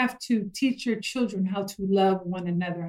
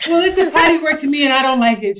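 put-on higher, louder and fast, a huffy child; imitating her offended daughter's outburst; indignant daughter; 6 semitones higher, 8 dB louder, faster than usual. Retelling her daughter's huff at the gut word, indignant.

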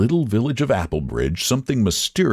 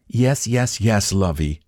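fond mother. A narrator's reading. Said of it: "the woman's voice went on".

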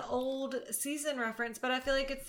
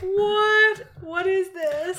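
much higher, loud disbelieving what; mock surprise; 10 semitones higher, 10 dB louder, much slower than usual. Imitating the couple's shocked reaction to Hannah. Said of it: "they're like".

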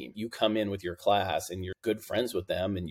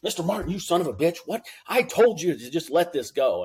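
a judge snapping at the lawyer, pitched up and loud; angry judge; 9 semitones higher, 5 dB louder than usual. Retold or imitated. Retelling the judge cursing at the young prosecutor, angry. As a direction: retell the judge's curse with real heat.

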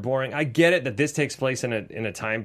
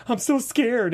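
a higher, mock-frightened damsel line; mock scared damsel; 9 semitones higher, slower than usual. Trailer heroine mocked as scared, high mock fear. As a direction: play the helpless scared girl, hammed-up. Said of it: "the girl's like".

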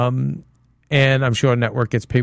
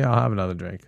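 low, quiet, poker-faced order; deadpan serious Irishman; 5 semitones lower, 6 dB quieter, faster than usual. Retold or imitated. Retelling the Irishman ordering another drink, deadpan and low. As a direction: play the straight-faced Irish drinker asking for more, lower and softer. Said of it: "and all serious".

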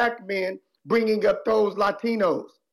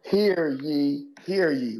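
booming herald calling the crowd to order; town crier; 3 semitones lower, much slower than usual. Pretend. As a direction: announce it like a medieval crier. Mock royal proclamation opening segment.

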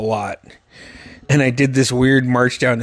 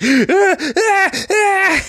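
high mock wailing sobs; imitated hard crying; much slower than usual. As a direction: bawl in hammed-up bursts. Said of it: "crying hard, like".

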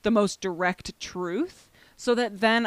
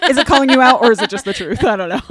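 teasing jab; 5 semitones higher, 12 dB louder, faster than usual. Real, not acted.

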